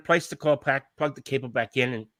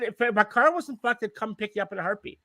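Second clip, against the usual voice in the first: exasperated insistence; 5 semitones higher, 3 dB quieter than usual. Retold or imitated. To the flatmate who thinks he's lying, exasperated insistence. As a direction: insist in retold exasperation, trailing softer. Retold protest at being doubted.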